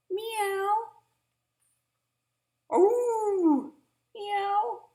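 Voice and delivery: high, loud cat meowing; cat meows. Pretend. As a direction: meow like a noisy cat. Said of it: "What the dogs and cats are saying".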